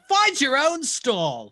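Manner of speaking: high, loud, drawn-out protest; indignant yell. Acted. Game master voices the stall's occupant, indignant yell. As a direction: yell it like an outraged man intruded on.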